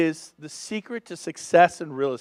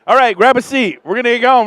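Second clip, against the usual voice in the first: ordinary talk, nothing marked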